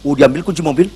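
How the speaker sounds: normal talking voice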